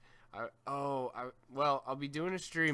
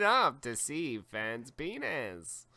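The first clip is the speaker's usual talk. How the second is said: slow, louder carny pitch; step-right-up sideshow call; carnival barker; 4 semitones higher, 8 dB louder, slower than usual. Acted. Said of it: "You're good at the Carney voice".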